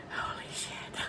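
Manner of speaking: whispered shock, barely voiced; stunned whisper. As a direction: breathe it out under your breath, stunned. First stunned reaction to news.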